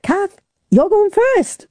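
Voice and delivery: high, drawn-out call across the room; announcing. Retold or imitated. Crew telling Kath she swims first, announcing. Retelling crew's announcement to her.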